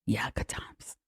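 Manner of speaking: deadpan whisper; whispered aside. Real, not acted. Viewer hearing a dark joke, whispered deadpan.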